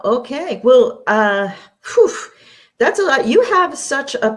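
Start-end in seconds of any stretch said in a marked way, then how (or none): 1.8-2.1 short puffed-out breath; whew. Real, not acted. Host reacts to guest's long life story, puffed whew. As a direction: blow out a quick, impressed whew.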